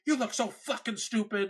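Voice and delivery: hammed-up furious father voice; angry dad. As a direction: imitate dad scolding. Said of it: "my dad is just like".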